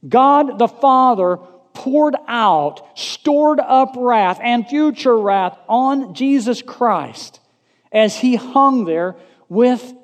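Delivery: high, loud, drawn-out preaching; forceful preaching. Real, not acted. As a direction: preach it out slowly and loudly.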